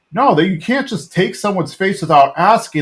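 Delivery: mock indignant objection; Hank. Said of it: "And Hank's all upset".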